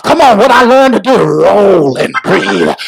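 high, half-chanted preacher's shout; full-force preaching. Real, not acted. Preacher urging congregation to use faith's technique, preaching at full force. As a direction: preach it to a climax, long-held key words.

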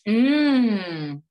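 loud high advert mmm; hammed-up refreshing mm; loud satisfied mm. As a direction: savour it like a drinks ad.

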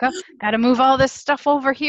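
mock effort, with a gasp; grip at work. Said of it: "like him working as a grip".

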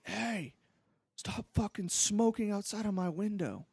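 high, angry neighbour yell, hammed up; retold yell. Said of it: "starts yelling".

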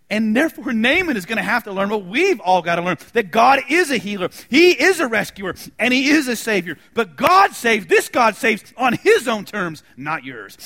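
loud, high preacher's build; booming pulpit cry; preaching. Acted. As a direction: proclaim it to the whole church. Preaching at full force.

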